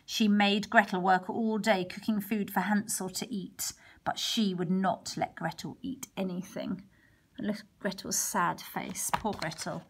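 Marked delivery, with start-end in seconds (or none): none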